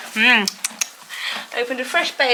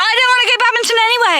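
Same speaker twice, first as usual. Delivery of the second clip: mock sulky outburst; 11 semitones higher, 5 dB louder, much faster than usual. Real, not acted. Joking after missing qualifying spot.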